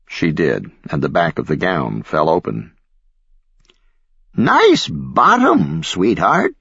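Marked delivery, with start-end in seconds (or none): 4.4-6.6 put-on croaky old lady, slow and high; papery voice. A narrator's reading. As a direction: croak it like a frail old woman. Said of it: "croaked the old lady".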